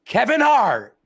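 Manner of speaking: big high, loud hype-man call; announcer introduction. Retold or imitated. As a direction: boom out the name like an arena host. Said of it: "do what they do nowadays".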